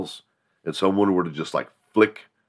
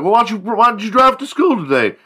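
doubtful classmate; 6 dB louder than usual. Retold or imitated. Classmate challenging the bragger in a high, loud voice. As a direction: poke holes in the brag.

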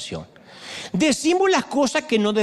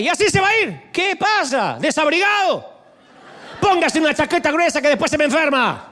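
mother yelling again; 6 semitones higher than usual. Retold or imitated. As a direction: scold him for leaving without a coat. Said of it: "me grita otra vez". (madre regañando al hijo desabrigado, grito alto y fingido)

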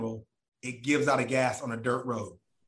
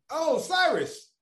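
high mock interviewer voice; slower than usual.

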